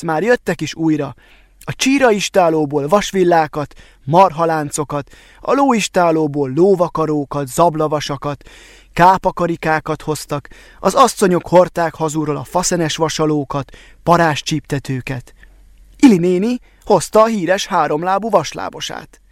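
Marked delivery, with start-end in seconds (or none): none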